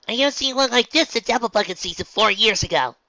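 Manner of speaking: high bright country voice; loud, twangy farm girl. Acted. Applejack character voice.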